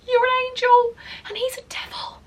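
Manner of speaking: very high, thin squeak; strained pitying lament. Retold or imitated. Doorwoman silently pitying the surprised wife, high strained lament. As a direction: squeak it out as if heartbroken for her.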